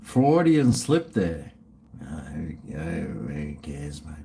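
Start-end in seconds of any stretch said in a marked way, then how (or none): 2.0-4.1 low, soft dismissive mutter. Real, not acted. Streamer brushing off his own slip, muttered low. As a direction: shrug it off under the breath.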